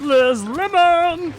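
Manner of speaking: high loud stretched call; drawn-out shout. Pretend. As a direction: belt it out, slow and high.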